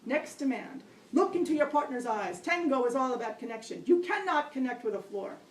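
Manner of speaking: barking orders. Retold or imitated. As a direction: command the class like a drill sergeant. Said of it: "Next barking order".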